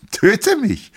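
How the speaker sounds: very high, loud chirp; wife dares her husband to kill her, chirping high. A narrator's reading. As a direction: chirp it lightly, almost playful. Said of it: "zwitscherte sie".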